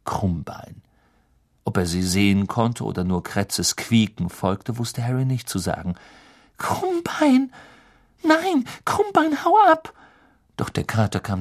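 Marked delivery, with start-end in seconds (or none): 0.0-0.8 low, hushed, ominous whisper; whispered name. A narrator's reading. 6.6-9.9 much higher, louder, slow desperate cry; alarmed shout. Acted. Hermine shouting at her cat.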